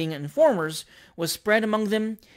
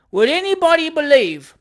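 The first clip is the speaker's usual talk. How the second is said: high, loud public appeal; appealing to crowd; 9 semitones higher, 8 dB louder than usual. A narrator's reading. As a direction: call out to onlookers, aggrieved. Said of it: "appealing to the crowd".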